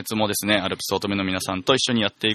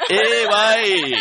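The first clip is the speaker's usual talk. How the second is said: laughing; 10 semitones higher, 5 dB louder, much slower than usual. Real, not acted. Host laughs at sloppy greeting.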